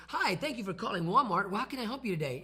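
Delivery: mock upbeat phone greeting at Walmart; cheerful receptionist. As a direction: sell the friendly Walmart greeting. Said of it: "they don't say".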